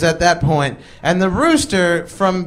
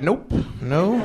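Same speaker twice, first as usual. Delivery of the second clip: feigned innocence; 5 dB quieter than usual. Retold or imitated.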